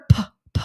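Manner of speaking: "p, p" as unvoiced puffs of breath; whisper-puff "p, p" with no vowel; bare p sound. Shown as a demonstration. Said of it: "beginning sound in the word".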